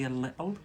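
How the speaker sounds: "little" with the t dropped; Scottish glottal "little". Shown as a demonstration. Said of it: "here in Scotland".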